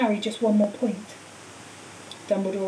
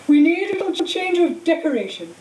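higher, louder call over the noise; calling over applause; 7 semitones higher, 4 dB louder than usual. A narrator's reading. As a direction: project above the cheering hall. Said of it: "Dumbledore called over the storm of applause".

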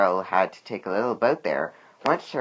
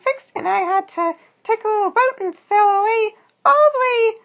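very high, loud squeaky worm voice; Cheryl recounting adventure; 6 dB louder than usual. A narrator's reading. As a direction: squeak the whole tale as a little worm. Cheryl tells Walter her adventure.

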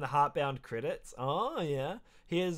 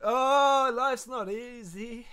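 teary; 5 semitones higher, 5 dB louder, much slower than usual. Real, not acted. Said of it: "I'm crying right now".

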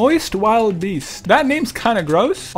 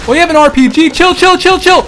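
alarmed shouting; 6 semitones higher, 7 dB louder than usual. Real, not acted. Enemy with RPG.

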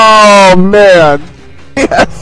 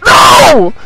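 usual speaking voice

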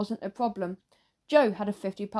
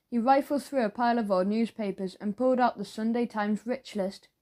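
ordinary delivery, nothing marked

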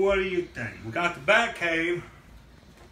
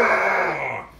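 loud pretend monster roar; big play-fight roar; dinosaur roar; 7 dB louder than usual. Dad plays the roaring dinosaur.

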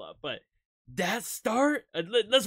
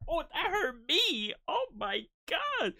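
much higher, stunned exclaims; shocked; 10 semitones higher than usual. Real, not acted. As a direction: react in disbelief. Shocked by the rapper's move.

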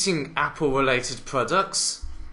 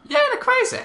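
very high, loud squeal; squealed exclamation; 6 dB louder, faster than usual. Acted. Commentator reacts to the party antics, high squeal.

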